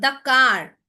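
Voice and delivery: car hit loud on ar; teacher stressing car; stressed car. Shown as a demonstration. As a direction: punch car for the class. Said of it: "The car sounds like R".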